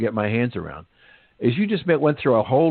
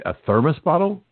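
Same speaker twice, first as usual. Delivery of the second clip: mildly acted can't-believe-it question; incredulous; 6 semitones higher than usual. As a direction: echo it back, rising in disbelief, pitched higher. Storyteller voices man's echoing disbelief.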